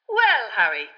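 the Minister introduces himself; Fudge introducing himself. A narrator's reading. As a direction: announce yourself importantly. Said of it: "said Fudge".

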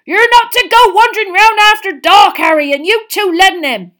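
loud, high scolding roar; Hagrid, roaring. A narrator's reading. Gamekeeper scolds children for wandering, roaring. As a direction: bellow the telling-off. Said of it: "he roared so suddenly".

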